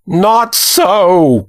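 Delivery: put-on high, wild old man's cry; high-pitched, fervent contradiction; older man crying out. A narrator's reading. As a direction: cry out as a wild-eyed elder. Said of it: "cried an older man whose eyes were wild".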